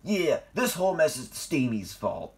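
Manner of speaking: heated agreement in a quarrel; angry blaming. A narrator's reading. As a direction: accuse them loudly, riled up. Quarrelling engine agrees and blames.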